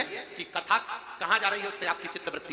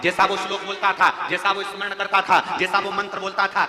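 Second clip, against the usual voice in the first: preaching at full force; 4 semitones higher, 9 dB louder than usual. Acted. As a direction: thunder the goddess's order to the crowd. Goddess's command, preached loud.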